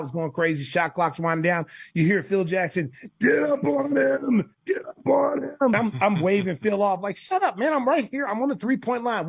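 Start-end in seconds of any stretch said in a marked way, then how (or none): 3.2-5.6 high, drawn-out strained coach call; mock sideline yell, pitched way up; imitated coach's yell. 7.2-9.3 high-pitched mock protest to coach; imitated exasperated comeback; retold exasperation. Waving off his coach mid-play.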